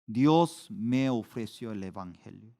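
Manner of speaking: usual speaking voice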